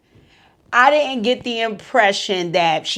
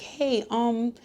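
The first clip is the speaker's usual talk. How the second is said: gentle offer; 5 semitones higher than usual. Retold or imitated. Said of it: "She was just gonna say".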